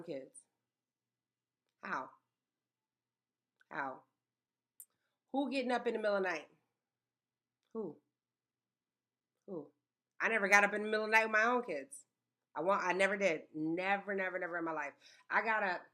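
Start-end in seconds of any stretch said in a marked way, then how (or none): 5.3-12.0 emphatic fast. Real, not acted. Emphatic rhetorical questions.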